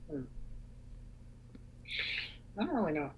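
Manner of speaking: normal talking voice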